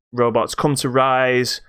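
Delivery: normal talking voice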